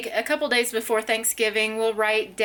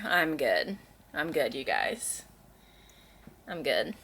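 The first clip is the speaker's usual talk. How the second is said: cat's indifferent voice; 8 semitones lower, 6 dB quieter than usual. Retold or imitated. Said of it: "she's like".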